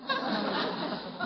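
breathy little chuckle under the breath; soft chuckle. Acted. Uncle chuckling at Leroy's excuse, a soft breathy laugh. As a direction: laugh softly at the kid's excuse.

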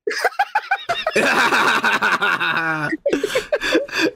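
high, far-from-speech cackling laugh; long laugh. Real, not acted. Cracking up at his own breakup story, long laugh.

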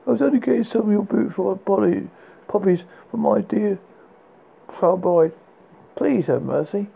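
fast, high pleading man; frightened thief. A narrator's reading. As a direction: beg for mercy, rushing.

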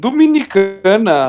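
loud, slow surprised echo. Real, not acted. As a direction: repeat it in disbelief.

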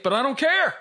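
high, loud and carefree; giddy defiance. Real, not acted. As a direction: toss it off with glee.

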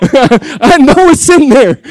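chuckling through the words, slowly; laughing. Real, not acted. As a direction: say it through a warm laugh, unhurried. Laughing sermon aside.

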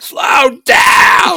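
high, loud yell; shouting. Retold or imitated. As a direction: yell it at someone rushing ahead. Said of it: "and I'm like".

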